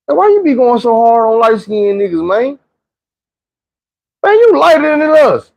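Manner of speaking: high-pitched, incredulous questioning; acted challenge. Light-skinned guy confronting the narrator, incredulous challenge. Acts out man confronting him.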